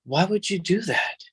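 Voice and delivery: a hint of a child calling her papa out; young daughter scolding. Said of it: "And then Francesca says to me".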